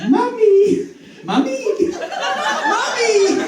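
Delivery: high laughing shout of Mummy; mock call of mummy. Greeting the best friend, high laughing shout. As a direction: yell it at your friend, cracking up. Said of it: "I'd be like".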